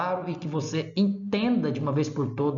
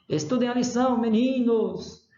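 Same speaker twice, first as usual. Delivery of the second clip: raised, lengthened vocative to pupils; calling out; 7 semitones higher than usual. Shown as a demonstration. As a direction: call after the boys, stretching "meninos". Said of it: "estou chamando mesmo a pessoa".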